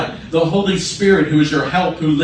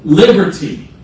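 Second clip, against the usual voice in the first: Liberty. rung out higher and louder; emphatic cry of Liberty; loud preached repeat; 4 semitones higher, 7 dB louder than usual. Real, not acted. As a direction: lift the second Liberty. up and out.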